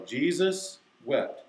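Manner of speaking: drawn-out verse recitation; slow, deliberate. Shown as a demonstration. Teacher showing kids now know it, slow and deliberate. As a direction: say it slowly, one word at a time. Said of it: "I have to say it slowly, deliberately".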